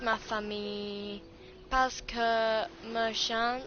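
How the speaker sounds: very slow French recitation, long held syllables; slow learner's French with pauses between chunks; practising French. Real, not acted. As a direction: recite from memory in halting French phrases. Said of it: "trying to speak French and remember".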